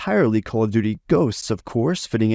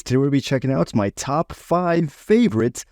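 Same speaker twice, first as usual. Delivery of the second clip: usual speaking voice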